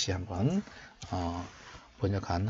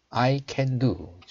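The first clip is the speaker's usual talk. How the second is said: slow, raised teaching voice; slow model reading; 3 semitones higher, 4 dB louder, much slower than usual. Shown as a demonstration. Teacher showing learners the English verse, slow model reading. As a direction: read it out slowly for learners to copy.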